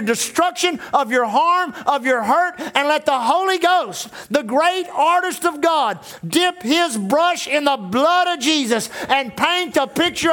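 a pulpit sermon in full flow; preaching. Acted. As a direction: preach it with building fervour to the church. Pastor preaching to the congregation.

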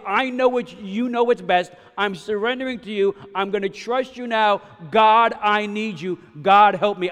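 loud, urgent prayer of surrender; crying out. Retold or imitated. Pastor acting out his surrender to God, crying out. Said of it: "just sit there and cry out".

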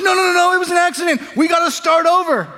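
high, loud pleading yell; protesting shout. Retold or imitated. Little brother shouting that the reset was an accident, high and loud. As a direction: beg loudly for a do-over.

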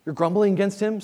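usual speaking voice

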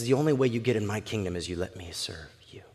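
low, near-whispered wonder; quiet, weighty statement; hushed quote. Acted. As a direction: say it very softly, dropping into a whisper. Preacher quotes Jesus in awe.

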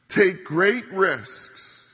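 "risks" punched loud and drawn out; slow, weighty push on "risks"; stress on risks. Real, not acted. Pastor urging the congregation to reach people, stressing "risks". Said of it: "emphasize the word risks".

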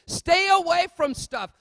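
preaching at full force. Real, not acted. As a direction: warn the church with fire. Preacher builds to a shout.

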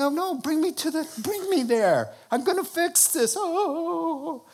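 very high, urgent protest; acted exasperation. Jesus to the mourners at the memorial, acted exasperation.

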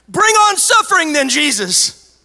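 very high, loud mock bravado; hammed-up defiant cry; mock bold shout. Imagined believers daring suffering, hammed-up shout.

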